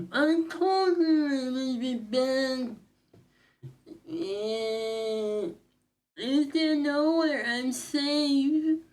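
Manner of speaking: very high, slow, whimpering voice; trembling little creature, afraid to go out; scared character voice. Acted. Voices a fearful game character.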